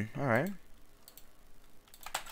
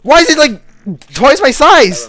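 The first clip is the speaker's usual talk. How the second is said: panicked screaming; 11 semitones higher, 11 dB louder, slower than usual. Real, not acted. Chased by a huge cicada.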